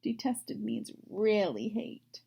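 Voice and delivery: usual speaking voice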